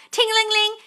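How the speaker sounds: high sing-song ting-a-ling; bell ringing sound. Pretend. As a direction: chime it like a tiny bell. Mimics a little bell ringing.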